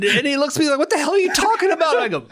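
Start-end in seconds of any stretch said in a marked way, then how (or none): 0.8-2.0 high, fast, exasperated; incredulous retort. Retold or imitated. Owner to the man yelling at a cow, incredulous. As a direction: imitate a baffled man snapping back.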